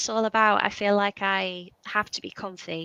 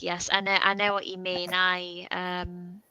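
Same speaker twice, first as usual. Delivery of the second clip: ordinary talk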